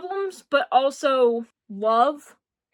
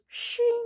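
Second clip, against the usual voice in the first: long, high, thin "shiiing"; drawn-out metallic ring, very high; dragged knife sound; 12 semitones higher than usual. Retold or imitated. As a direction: stretch out shing like a blade scraping along a table. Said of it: "tries dragging it across the table".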